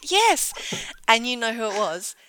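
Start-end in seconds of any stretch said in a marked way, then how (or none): 0.1-0.5 high, rising startled yes; suddenly realising the mistake; startled realisation. Retold or imitated. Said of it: "He's like".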